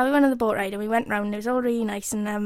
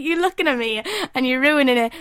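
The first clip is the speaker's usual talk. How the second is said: ordinary talk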